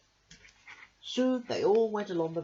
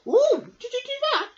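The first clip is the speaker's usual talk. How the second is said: put-on squeaky high excited boy, loud; high, loud child's delight; 9 dB louder than usual. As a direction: gasp it out like an amazed little boy. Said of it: "asked Mick".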